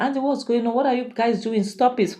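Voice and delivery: high, urgent stop it; alarmed call. Retold or imitated. Munachi rushing downstairs to the fighting men, high alarmed call.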